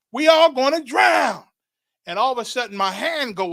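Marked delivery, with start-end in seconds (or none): 0.1-1.4 higher, louder and slower shout of despair; the panic grows on the repeat; bigger panicked shout. Retold or imitated. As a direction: draw it out, bellowing that all are lost.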